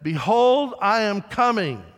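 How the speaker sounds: loud ringing pulpit declaration; preacher's proclamation. Retold or imitated. Preacher announcing the Lord's coming, loud and ringing. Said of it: "he got to the point where he said".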